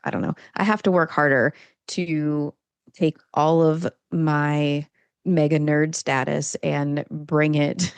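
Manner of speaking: usual speaking voice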